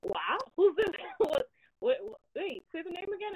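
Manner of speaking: imitating a shocked cousin; cousin's surprise. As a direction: pitch up, stunned on the phone. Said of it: "And he goes".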